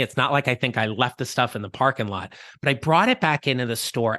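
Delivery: ordinary talk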